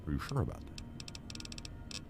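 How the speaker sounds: slow, breathy near-whisper; mock whisper. Gamer teasing himself over ammo, slow mock whisper. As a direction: hush it like a teasing conscience.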